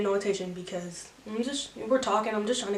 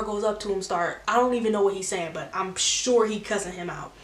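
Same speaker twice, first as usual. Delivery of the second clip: usual speaking voice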